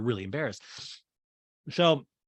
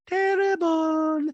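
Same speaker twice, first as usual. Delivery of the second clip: high squeaky drawn-out terrible; 5 dB louder, much slower than usual. Retold or imitated. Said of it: "Diana's like".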